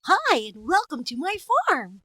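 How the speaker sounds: high, put-on greeting; cartoon farm character. Character welcoming people to the farm, comic voice. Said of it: "It's like".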